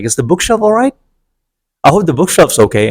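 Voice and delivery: fake-sweet sarcastic question. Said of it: "I was so sarcastic".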